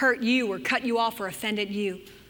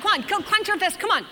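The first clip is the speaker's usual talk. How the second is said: usual speaking voice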